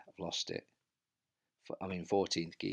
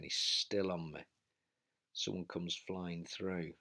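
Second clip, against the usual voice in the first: usual speaking voice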